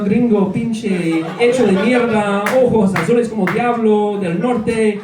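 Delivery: bold emcee Nicolas voice; confident Spanish-speaking alter ego, playing to the crowd; Nicolas persona. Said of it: "This is the persona that I do when I speak Spanish".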